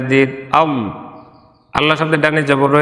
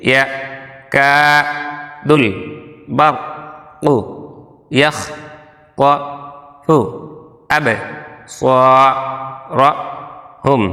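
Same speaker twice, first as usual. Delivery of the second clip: syllable by syllable. Shown as a demonstration. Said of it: "আমরা আগে ভাঙা ভাঙা উচ্চারণ করি".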